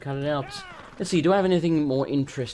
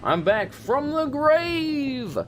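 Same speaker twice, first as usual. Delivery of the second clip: high, drawn-out mock-dramatic announcement; hammed-up resurrection cry; 12 semitones higher, much slower than usual. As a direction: stretch it out high like rising from the dead. Hammed-up comeback cry in game.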